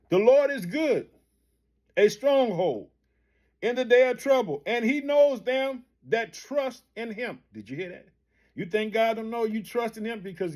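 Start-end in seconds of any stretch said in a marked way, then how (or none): 0.1-7.4 scripture reading. Acted. Reading scripture verse with emphasis.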